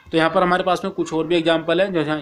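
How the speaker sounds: normal talking voice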